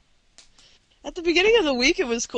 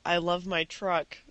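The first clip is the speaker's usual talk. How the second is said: lower, quieter butch voice; 9 semitones lower, 11 dB quieter than usual. Pretend. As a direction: play it deep and tough.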